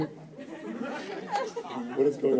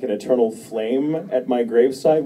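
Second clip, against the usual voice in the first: ordinary talk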